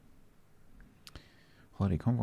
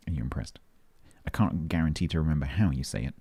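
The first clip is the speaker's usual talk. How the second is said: ordinary talk, nothing marked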